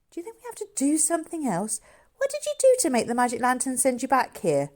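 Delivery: a hint of an impatient boy pressing for answers; boy George. George voiced in the story.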